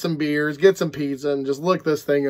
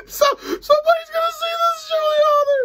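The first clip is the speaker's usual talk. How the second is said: high, teary laughing talk; crying with laughter. Real, not acted. Imagining the author watching, crying with laughter. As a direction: talk through tears of laughter. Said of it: "I can't keep a straight face".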